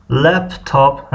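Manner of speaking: "لپتاپ" drawn out slowly, syllable by syllable; slow word demo. Shown as a demonstration. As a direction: say "لپتاپ" slowly in two separate parts. Teacher repeats the word slowly. («لپتاپ» آهسته و هجا به هجا)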